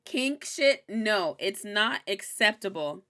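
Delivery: usual speaking voice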